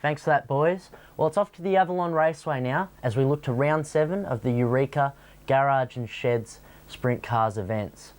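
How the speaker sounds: normal talking voice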